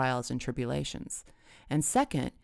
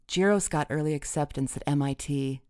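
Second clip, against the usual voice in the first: plain reading voice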